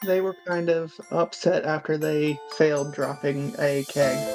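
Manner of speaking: ordinary delivery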